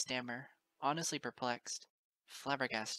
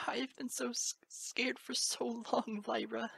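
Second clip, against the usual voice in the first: breaking whisper; 8 semitones higher than usual. Acted. Moon Dancer clinging to Lyra, whispered sob. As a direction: break down in her arms.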